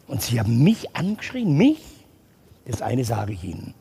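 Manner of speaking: put-on a boy threatening with his dad. Child threatens teacher.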